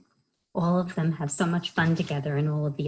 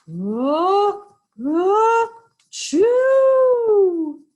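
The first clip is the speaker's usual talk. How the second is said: rising build-up to an explosive sneeze; high, loud cartoon achoo; huge fake sneeze; 11 dB louder, much slower than usual. A narrator's reading. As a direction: wind up twice, then let it blast. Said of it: "he blows and he sneezes".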